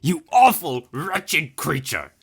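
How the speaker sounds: high, loud furious shriek, slowing on creature; enraged shriek. Acted. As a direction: scream the insult in rage. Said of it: "shrieked the Hierophant in rage".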